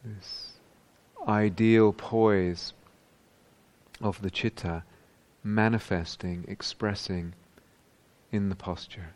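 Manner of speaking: unhurried meditation voice with long pauses; guided meditation. Real, not acted. Teacher guiding meditators into whole-body awareness, calm and spacious. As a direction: guide them calmly, leaving space after each phrase.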